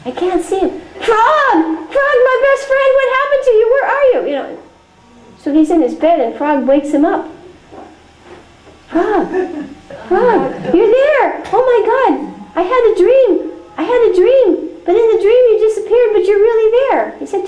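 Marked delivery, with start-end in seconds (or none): 0.7-4.2 much higher, panicked calls for a lost friend; frantic calling. Pretend. Toad searches the empty auditorium, panicked high calling. 8.9-17.1 much higher, laughing with relief; relieved joy. Pretend. Toad wakes to find Frog there, laughing relief.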